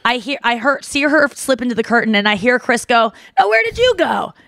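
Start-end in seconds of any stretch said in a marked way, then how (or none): 3.4-4.3 imitating casual, higher-pitched chit-chat; small talk. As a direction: lightly play him making small talk. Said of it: "trying to make small talk".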